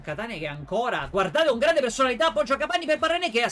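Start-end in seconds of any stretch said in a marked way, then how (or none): 1.1-3.5 high, loud, fast rising commentary; excited play-by-play. Real, not acted. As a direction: speed up as the attack builds. Live play-by-play attack builds. (telecronaca concitata e veloce)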